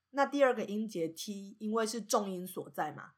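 ordinary talk

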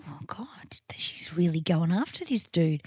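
hushed, breathy awe; whispering. Retold or imitated. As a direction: whisper it like a secret thought. Retold childhood thought, awed.

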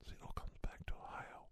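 breathy secret whisper, dropped right down; conspiratorial whisper. Acted. Ranting conspiracy man to his audience, conspiratorial whisper. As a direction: hush it like a secret only you know.

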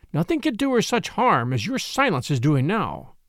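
plain reading voice

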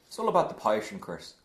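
lightly put-on awful Irish accent; a hammed-up Irish pundit voice, barely shifted; Paul McVeigh Irish accent. Joking about a pundit on the podcast, in a mild, bad Irish accent. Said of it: "That is an awful Irish accent".

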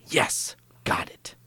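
imitated villain's gleeful, slow whisper; creepy, drawn-out whispered triumph. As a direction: hiss it slowly, like a creep getting his way. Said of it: "Yeah, he's really creepy".

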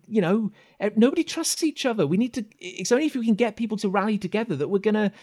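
put-on high, fast child voice; rally them as a worried, high-voiced kid; earnest kid. Kid rallying people to fix the roundhouse, high and urgent. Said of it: "The kid".

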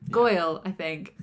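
usual speaking voice

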